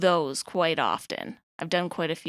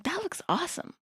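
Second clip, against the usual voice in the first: younger self admiring; 11 semitones higher, 6 dB quieter than usual. Retold or imitated. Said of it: "I'm like".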